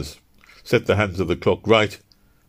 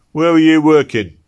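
loud, high gruff constable voice; a stern London bobby challenging him; london policeman; 10 semitones higher, 10 dB louder than usual. Acted. Policeman voice in story.

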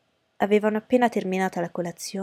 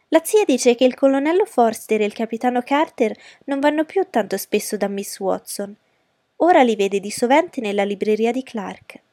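put-on louder, lively young gossip; exclaiming; 4 semitones higher, 6 dB louder than usual. A narrator's reading. Daughter telling her mother about the officers, exclaiming excitedly. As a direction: burst in eagerly with the news. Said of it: "esclamò Lydia".

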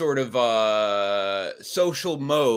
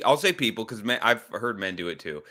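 ordinary delivery

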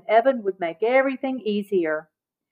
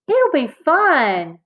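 high, drawn-out, upbeat reassurance; eager little-sister persuasion; cheerful coaxing; 5 semitones higher, 3 dB louder, much slower than usual. A narrator's reading. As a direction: sing out the encouragement slowly. Sister cheerily persuades angry brother.

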